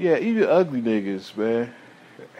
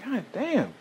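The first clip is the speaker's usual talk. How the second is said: stunned disbelief; 11 semitones higher than usual. Retold or imitated. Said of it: "I'm like".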